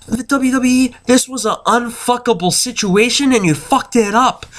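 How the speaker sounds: high-pitched exasperated rebuke; fed-up fan telling them off. Retold or imitated. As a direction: scold the company in disbelief. Acts out his exasperation at company.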